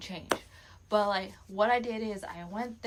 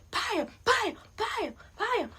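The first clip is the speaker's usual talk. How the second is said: mock crowd bam; 12 semitones higher, 4 dB louder, slower than usual. Imitating crowd noise.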